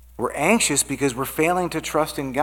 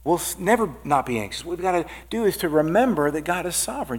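ordinary talk

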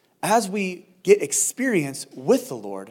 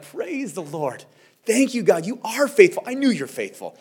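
joyful praise; 5 semitones higher, 4 dB louder than usual. Retold or imitated. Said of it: "and you're like".

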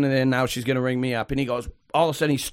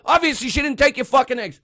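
high, incredulous retold rant; pissed off; 9 semitones higher than usual. Bystander defending cashier to customer, pissed off. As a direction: re-enact telling off a rude stranger. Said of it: "Now, I'm getting pissed off".

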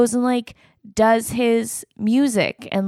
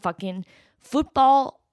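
ordinary talk, nothing marked

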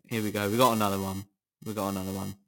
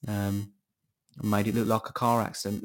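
ordinary talk, nothing marked

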